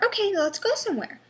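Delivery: a hint of someone nagging her to go out; imitating a pushy relative. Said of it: "get in the car".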